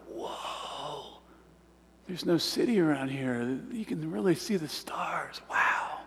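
hushed, breathless whisper of wonder; amazed whisper. Retold or imitated. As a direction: whisper it like staring at a starry sky. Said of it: "I was just amazed".

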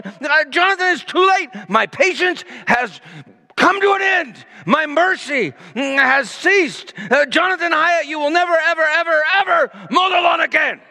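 put-on over-the-top father scolding his teenage son; screaming father. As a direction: play the furious dad, high and shouting. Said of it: "I just began to scream".

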